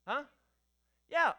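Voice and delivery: ordinary talk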